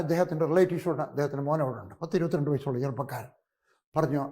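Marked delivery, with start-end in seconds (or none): none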